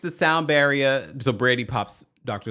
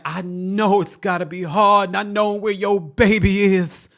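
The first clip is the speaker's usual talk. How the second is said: high, loud tearful imitation; hammed-up weepy sympathy; mock performative anguish; 9 semitones higher, 6 dB louder than usual. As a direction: wail it in mock melodrama. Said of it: "performative, performative".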